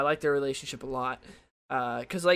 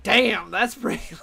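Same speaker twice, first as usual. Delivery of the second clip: stunned reaction; 5 semitones higher, 6 dB louder, slower than usual. Retold or imitated. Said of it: "And I was like".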